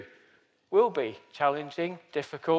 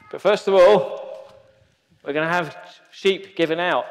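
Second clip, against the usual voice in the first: announcing; 3 semitones higher, 6 dB louder than usual. Real, not acted. Announcing to the congregation.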